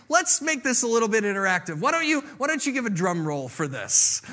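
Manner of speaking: ordinary delivery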